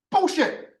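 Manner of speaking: high-pitched, fed-up outburst; exasperated rant. Real, not acted. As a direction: vent it, voice pushed up high. Ranting, finished with the director.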